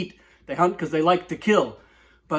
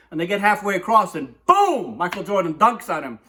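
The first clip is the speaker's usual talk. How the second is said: ordinary talk, nothing marked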